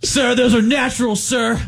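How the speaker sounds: high yelped answer to sergeant; nervous private. Retold or imitated. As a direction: squeal it like a scared recruit.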